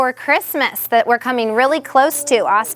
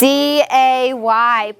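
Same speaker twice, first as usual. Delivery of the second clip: "D A Y" slowly, letter by letter; spelling out; much slower than usual. Shown as a demonstration. Teacher confirming a pupil's spelling, slow and separated. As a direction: stretch each letter name in "D A Y". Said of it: "How do we spell day?".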